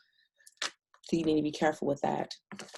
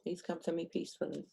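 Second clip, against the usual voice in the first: quiet, soft plea; gentle request; 2 semitones higher, 10 dB quieter than usual. Real, not acted. Asking spirits to come peacefully, quiet gentle request. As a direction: ask softly, almost to yourself.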